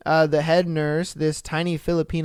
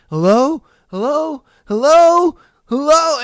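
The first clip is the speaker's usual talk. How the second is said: high, rising, louder panicked hellos into a silent phone; desperate husband calling; 10 semitones higher, 8 dB louder than usual. Retold or imitated. Husband on phone calling to his dying wife, higher and louder in panic. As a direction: call out again and again, getting frantic. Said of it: "And you're just sitting there like".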